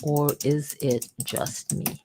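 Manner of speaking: slow, measured punchline, every word spaced out; comedic slow delivery. Shown as a demonstration. Host adding comedic humor to a script, slow deadpan punchline. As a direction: draw it out slowly for comic effect. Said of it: "throwing in a little bit of my comedic humor".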